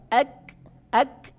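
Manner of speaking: ek with breath and no bounce; light ek release; breathy ek. Shown as a demonstration. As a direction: let ek out as breath only. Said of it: "Kaf hanya mengeluarkan nafas".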